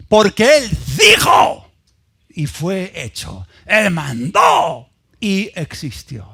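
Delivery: loud and slow on the first words, then dropping; emphatic proclamation. Acted. Preacher declares how God created, slow and weighty. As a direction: declare it slowly, word by word. Emphatic recital of verse nine.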